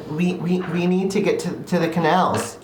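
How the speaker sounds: mild mock scream. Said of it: "he screams".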